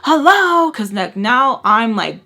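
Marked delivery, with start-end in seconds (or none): 0.0-0.7 loud, high, drawn-out hello; indignant hello. Retold or imitated. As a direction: call him out, incredulous. Retold indignant calling out to him.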